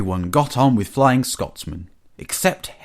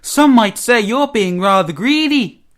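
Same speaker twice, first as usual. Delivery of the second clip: high, loud needling engine voice; high and pointed teasing; teasing engine James; 11 semitones higher, 6 dB louder than usual. A narrator's reading. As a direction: pipe up loudly with a high-pitched jibe.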